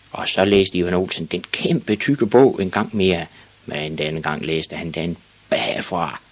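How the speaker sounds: plain reading voice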